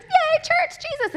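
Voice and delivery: high-pitched, over-bright cheering; squealed fake enthusiasm; mock cheer. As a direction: put on a gushing churchgoer's joy. Mock cheerful chatter after church.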